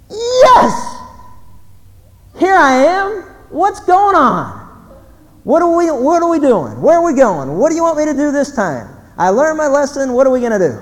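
high, loud, excited outburst; overjoyed eagerness. Acted. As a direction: shout it like you can't wait to get going. Moses' imagined eager answer to God.

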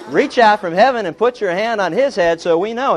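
hammed-up prayer, calling up to heaven; loud mock prayer. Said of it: "You know".

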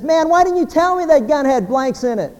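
worked-up joke character; acted exasperation. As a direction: complain like a man back from a struggle. Wife-killer returns from struggle in joke.

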